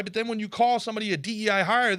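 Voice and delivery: ordinary talk, nothing marked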